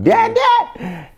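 put-on a child yelling for her father from another room; child calling Dad. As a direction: shout it like a little girl calling across the house. Daughter calling him repeatedly.

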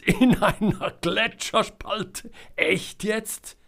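hoch und lachend hervorgeprustet; lachend ungläubig. A narrator's reading. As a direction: vor Lachen kaum glauben können. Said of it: "Jonas gröhlte und schrie". (Jonas prustet lachend über Selmas Unfall, hoch und ungläubig)

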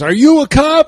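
high, loud mock interrogation; hammed-up movie gangster demanding an answer; Frank Costello. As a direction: raise pitch and volume, grilling a suspected informant. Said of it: "The one scene where Costello goes".

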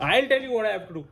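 imitating the enraged uncle's threat; flared-up threat. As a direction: answer like he's about to hit. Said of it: "वो भड़क गया".

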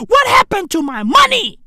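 normal talking voice

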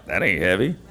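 low, quiet scoffing voice; deep, soft laughing scoff; mock dismissive self. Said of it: "And I'm like".

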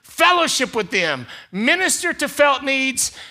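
loud, high preaching build; full-force preaching. Acted. Pastor preaching loudly to the congregation. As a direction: preach it out to the church.

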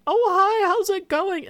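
very high, loud bubbly hello; high chirpy greeting. Retold or imitated. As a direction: squeal a fake-friendly hi. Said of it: "they're like".

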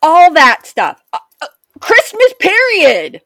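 loud, high indignant rant. Real, not acted. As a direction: vent your outrage loudly. Ranting about Hobby Lobby.

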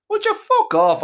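high, loud snap; exasperated outburst. Acted. Dizzy boy telling his mate to back off, loud and high. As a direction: blurt it out way up high. Sick boy snaps at friend.

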